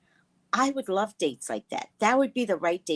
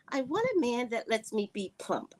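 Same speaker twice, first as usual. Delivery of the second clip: usual speaking voice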